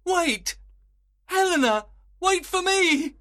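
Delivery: very high, loud pleading call; crying out. A narrator's reading. Lysander calls after departing Helena, high urgent cry. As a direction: call after someone walking away. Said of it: "cried Lysander".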